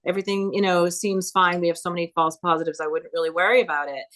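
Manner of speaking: usual speaking voice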